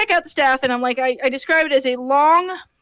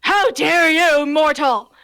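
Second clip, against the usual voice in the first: demon lord villain; 3 semitones higher, 3 dB louder, slower than usual. Retold or imitated. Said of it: "he says".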